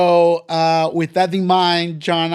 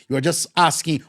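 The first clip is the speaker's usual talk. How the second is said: normal talking voice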